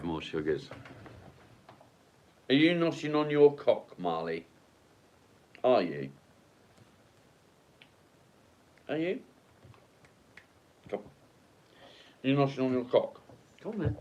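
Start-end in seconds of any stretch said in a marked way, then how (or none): 2.5-14.0 high, playful teasing voice; teasing dog talk. Real, not acted. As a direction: tease the dog with rising questions.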